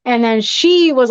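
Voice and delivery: ordinary talk, nothing marked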